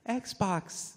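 high, loud, overly polite command to the Xbox; mock pleading command. Parent begging the Xbox to obey, high and loud. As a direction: beg the Xbox in a high put-on voice. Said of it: "I have to".

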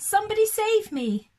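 rabbit's high, loud plea for help; panicked shout. Acted. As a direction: beg to be rescued from the tower. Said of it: "he shouted".

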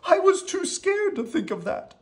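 very high timid-creature voice; high-pitched anxious storybook voice; scared little character. Acted. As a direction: squeak it out, high and frightened. Scared Rocky admits her fear.

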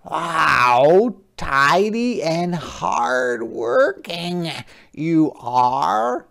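high, slow, gentle crab voice; a small polite sea creature asking; Hermit Crab voice. Acted. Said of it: "said Hermit Crab".

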